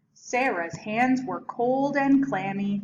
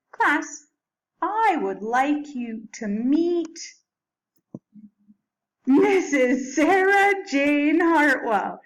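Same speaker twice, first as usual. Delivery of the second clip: slow, louder, higher formal reveal; grand announcement; 6 semitones higher, 6 dB louder, slower than usual. Acted. Principal presenting Sarah to the class, slow and grand. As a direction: build up to the big reveal.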